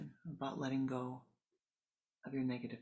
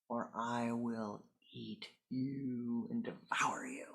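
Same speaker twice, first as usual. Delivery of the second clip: slow threatening whisper; menacing demon whisper; 3 semitones lower, slower than usual. Acted. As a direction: breathe the threat slowly like a demon.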